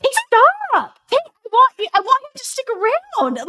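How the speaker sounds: very high, loud nagging at husband; mock mean wife. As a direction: shriek at Tay in a shrill mock nag. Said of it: "she can be really mean to him".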